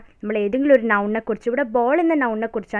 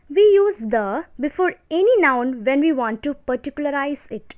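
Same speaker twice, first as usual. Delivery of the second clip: dictation; 3 semitones higher, much slower than usual. Shown as a demonstration. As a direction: read the rule out slowly for notes. Dictating grammar rule slowly.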